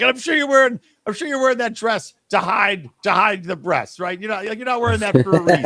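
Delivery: high, pressed mock protest; sarcastic comeback. Man sniping back about her dress, sarcastic.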